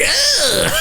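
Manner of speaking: high startled "Ah!" imitating mom; mock shocked shriek. Son imitating mom's shriek at his mustache. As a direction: yelp like you just saw the mustache.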